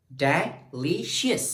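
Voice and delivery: "delicious" slowly parted, middle syllable held. Shown as a demonstration. Teacher pointing out second syllable, "delicious" broken apart. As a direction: say "delicious" as de, LI, shus with pauses. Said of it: "ली हमारे पास second जो syllable है".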